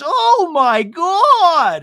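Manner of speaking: very high, loud, drawn-out gasp; a hammed-up horrified shriek; shocked Gina gasp. Podcaster imitates Gina reacting to the insult in shock. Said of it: "Gina goes".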